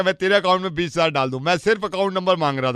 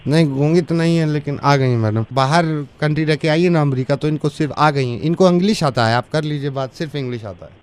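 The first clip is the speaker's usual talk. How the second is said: lightly voiced servant; 10 semitones higher than usual. Servant calming angry mother on phone, lightly voiced. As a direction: plead as the humble servant. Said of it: "मैं इनके घर नौकर हूँ".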